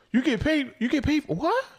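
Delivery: high astonished mimic; amazed mock question. Host mimicking his wife's shock at app pay, high incredulous voice. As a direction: squeak it like a wide-eyed wife hearing about money. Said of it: "got big-eyed, bug-eyed over here".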